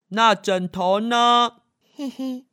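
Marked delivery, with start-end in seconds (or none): none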